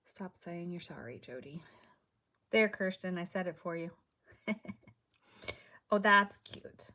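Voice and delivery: normal talking voice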